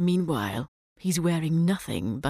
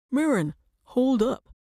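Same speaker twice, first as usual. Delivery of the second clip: higher-pitched call across the water; raised, put-on voice hailing someone; 7 semitones higher, 3 dB louder than usual. A narrator's reading. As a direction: call after a swimmer to wait. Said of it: "calls he".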